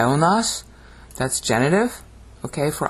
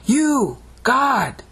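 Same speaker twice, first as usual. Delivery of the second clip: two high, separate, drawn-out cries; dramatic; 10 semitones higher than usual. Shown as a demonstration. Teacher showing how English should say it, dramatic. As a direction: proclaim each word alone, high and ringing. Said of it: "It's dramatic".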